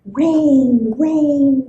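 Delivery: plain reading voice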